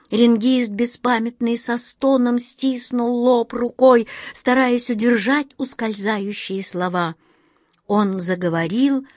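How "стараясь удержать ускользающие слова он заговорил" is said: ordinary delivery, nothing marked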